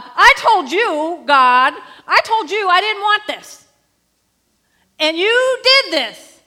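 loud, high, slow accusing cry; anguished shout. Acted. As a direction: pour out the grief as a bold accusation. Woman confronting God boldly.

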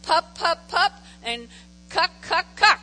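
put-on short, punchy baby syllables; imitating baby babble. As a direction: mimic a one-year-old's babble. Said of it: "and he says".

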